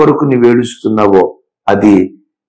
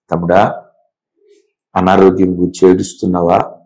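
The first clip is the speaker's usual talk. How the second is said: ordinary delivery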